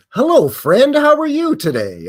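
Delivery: high, loud, sing-song hello to a stranger; a hammed-up chirpy hello, pitched high; overly friendly greeting. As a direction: greet a passerby with fake cheer. Mock greeting to every stranger.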